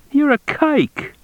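slow, very high-pitched accusation; accusing cop. A narrator's reading. Cop accuses Frink with a slur.

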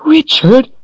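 high, loud indignant squawk. A narrator's reading. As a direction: squawk his name in outrage. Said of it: "the woman squawked".

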